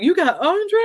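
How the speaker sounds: imitating a surprised friend, pitched high and loud; high, loud mock disbelief; retold astonishment. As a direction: quote someone who can hardly believe it. Retells his surprised reaction to guest.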